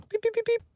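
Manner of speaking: very high, soft beeps; tiny electronic beeps done by mouth; imitated beeping. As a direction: squeak out a string of beeps. Imitates the credits cutting in.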